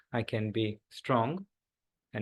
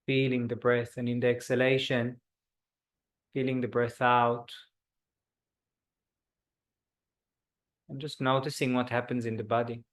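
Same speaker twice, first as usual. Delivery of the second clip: guided meditation. Acted. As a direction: ease the group into stillness. Leads a body scan meditation.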